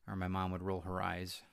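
ordinary talk

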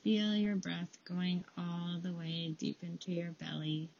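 calm them down with a quiet voice; guided meditation. Acted. Leads a breathing meditation.

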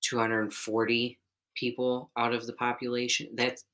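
ordinary delivery

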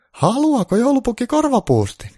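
very high, loud sugary granny; high granny voice all sweetness; sweet granny. Acted. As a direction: play a granny turned suddenly sweet. Granny offering a bun.

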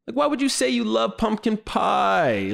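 high-pitched exasperated question; mock-pained high incredulity; incredulous. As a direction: question it in comic disbelief. Exasperated at the retold blunder.

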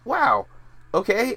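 high, fast, still stunned; amazed. Real, not acted. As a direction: still reeling from the surprise. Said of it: "my mind was blown just now".

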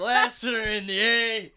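slow, laughing through it. Real, not acted. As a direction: giggle through the words. Laughing through crude joke.

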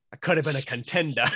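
mock Brando accent, dropping the final r. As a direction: tease with an exaggerated Brando accent. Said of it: "Not in the Brando accent".

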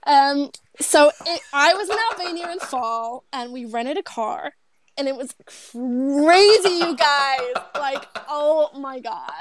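put-on American accent, drawn out. Shown as a demonstration. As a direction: exaggerate an American accent, slowly. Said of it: "this whole podcast in an American accent".

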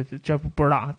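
ordinary talk, nothing marked

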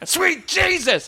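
wrestling commentator. Pretend. Jim Ross bit.

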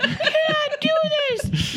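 very high retold parental outburst; mock exasperated scolding. Parents scolding crying daughter in the store, mock exasperation. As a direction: scold in a high, straining pitch.

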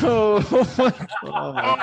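high, laughing oh my gosh; laughing at the dog story; laughing shock. Real, not acted. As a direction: gasp it out through a laugh.

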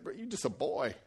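quiet dismissal. Acted. Saul dismisses David's offer.

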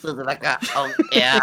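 robot voice. Pretend. Robot jingle again, laughing.

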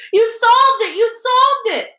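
very high, loud, elated cry; screaming. A narrator's reading. Hermione running towards Harry, screaming. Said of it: "Hermione running towards him screaming".

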